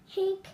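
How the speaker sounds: high little hiccup. Acted. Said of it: "Dad said".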